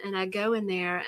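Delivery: usual speaking voice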